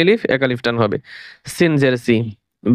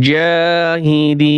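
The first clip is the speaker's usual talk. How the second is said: slow drawn-out reading; much slower than usual. Shown as a demonstration. Teacher to students, showing how to blend the syllables, slow chanted reading. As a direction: stretch every syllable, very slow and sung out. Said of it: "বানান করে পড়বেন".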